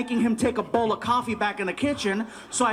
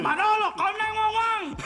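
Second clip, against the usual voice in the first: alarmed waiter; 11 semitones higher than usual. Retold or imitated. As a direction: imitate a waiter calling for emergency help. Waiter alerting cook after coffee is drunk.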